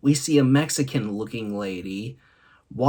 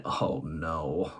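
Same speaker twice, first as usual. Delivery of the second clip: deep, soft "Oh, no" groan; catchphrase groan, low and soft; low dismayed groan; 7 semitones lower, 8 dB quieter, much slower than usual. Retold or imitated. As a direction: drop low and quiet in dismay. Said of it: "thinking to myself, shaking my head".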